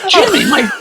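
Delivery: high-pitched imitated outrage; shocked protest. Woman reacts to his distasteful joke, high shocked protest. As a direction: scold him in mock shock, voice jumping up.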